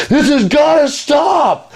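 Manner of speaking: high, slow, strained yell of despair; desperate shout. Retold or imitated. Cursed man to his tormentor, a slow high desperate shout. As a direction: wail it out like a broken man at the end of his rope. Victim's desperate outburst quoted.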